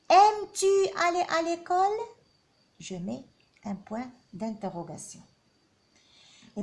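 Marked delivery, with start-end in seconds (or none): 0.1-2.0 "Aimes-tu" jumps very high and loud, rising to "l'école"; rising question tune. Shown as a demonstration. Teacher exaggerates the rising question for pupils. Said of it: "L'intonation monte".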